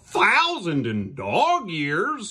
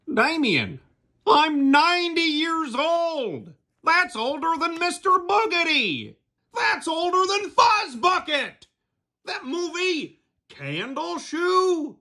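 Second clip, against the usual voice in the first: proud shout; 3 dB louder than usual. Acted. Ninety-year-old puppet shouting his age at Damien. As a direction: trumpet your age to the room.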